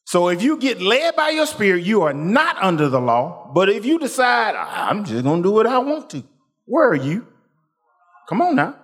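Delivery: high, fervent preaching climb. Acted. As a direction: build the sermon to a peak. Preaching rises again.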